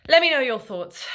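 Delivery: energetic sign-off line; upbeat call out. Real, not acted. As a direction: call out to the viewers.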